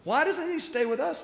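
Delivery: high, mock-outraged crowd voice; indignant grumbling. Church folk grumbling that Jesus snubs them, mock outrage. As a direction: squeak it out like scandalized churchgoers. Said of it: "everybody's going".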